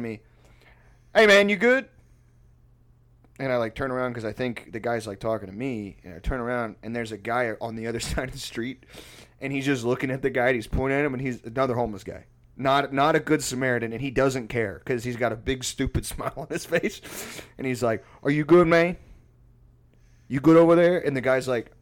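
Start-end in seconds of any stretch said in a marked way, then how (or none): 1.1-1.9 high, loud call across the street. Retold or imitated. Stranger calling from across the street, loud call. 18.2-21.0 loud, higher hollered check-in; calling out. Retold or imitated. Homeless guy checking on passed-out man, loud call.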